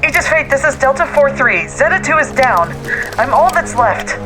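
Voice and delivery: urgent radio call. Acted. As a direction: shout the report over the radio. Lone survivor radios the ship.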